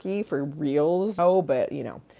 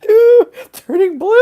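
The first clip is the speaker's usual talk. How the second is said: much higher, louder laughing squeal; laughing hard; 13 dB louder, slower than usual. Real, not acted. Streamer recalling laughing at Maria Bamford, squealing laugh.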